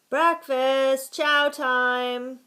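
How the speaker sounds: high, loud, long-held holler; boys' yell. A narrator's reading. Boys announcing the meal as bell clangs, yelling. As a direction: yell it across the camp. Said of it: "yelled the boys".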